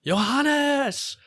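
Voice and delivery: acted searching cry at talking volume; imitated crying out. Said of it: "standing out on the dunes crying".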